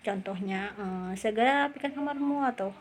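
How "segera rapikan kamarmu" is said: example order, pitched up high; command intonation. Shown as a demonstration. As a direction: give a sample command with raised, sustained pitch. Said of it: "intonasinya perintah atau agak naik".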